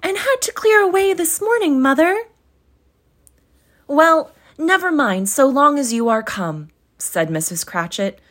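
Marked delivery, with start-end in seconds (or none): 0.0-2.2 put-on much higher, louder girl explaining; a daughter explaining herself; high girlish reply; young girl's voice. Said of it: "replied the girl". 3.9-6.7 higher, louder motherly reassurance; soothing mother. Acted. Mother comforting daughter.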